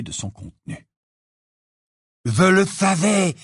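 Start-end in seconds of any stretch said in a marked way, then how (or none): 2.2-3.3 loud, high, drawn-out exclamation; triumphant cry. A narrator's reading. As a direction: cry out in victory. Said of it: "s'écria Ron en donnant un coup de poing".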